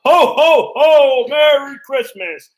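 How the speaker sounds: high, loud, drawn-out holler; Santa-style yell. Retold or imitated. Dad waking sleeping kids on Christmas morning, Santa-style yell. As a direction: boom out a festive wake-up call, pitched high. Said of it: "and I yell".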